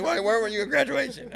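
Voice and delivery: high mock voice of a confused buddy; mock friend asking. Buddy at the door asking why he skipped graduation, high mock voice. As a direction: squeak it up like a nosy friend.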